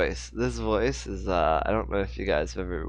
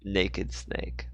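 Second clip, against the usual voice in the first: usual speaking voice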